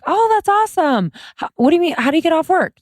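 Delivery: high, gushing mock enthusiasm; pretend delight. Co-host models the supportive reply mom skipped, pretend delight.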